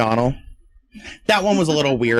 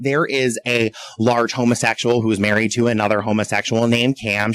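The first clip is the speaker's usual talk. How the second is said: ordinary delivery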